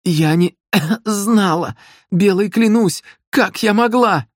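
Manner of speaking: high, choked, desperate cry; strangled, high-pitched protest of innocence; tearful pleading. A narrator's reading. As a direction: plead for your life through tears.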